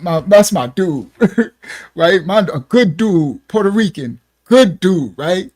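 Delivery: ordinary talk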